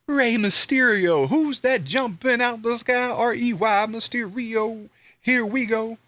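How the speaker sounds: high, slow hype-man call; wrestling announcer. Pretend. Fan joking about Rey's name, high slow announcer hype. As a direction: hype the entrance like a ring announcer.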